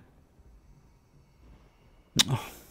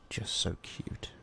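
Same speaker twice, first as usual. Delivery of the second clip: whisper; 6 dB quieter, slower than usual. Acted. Cooing over sleeping captive.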